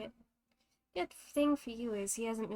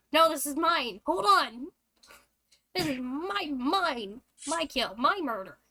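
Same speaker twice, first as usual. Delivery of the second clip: loud, raised-pitch objection; possessive protest; 4 semitones higher, 8 dB louder than usual. Acted. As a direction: indignantly claim the kill as yours. Rival steals the intended kill.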